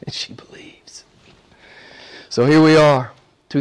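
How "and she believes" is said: soft breathy whisper. Real, not acted. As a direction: whisper it with awe. Whispered climax.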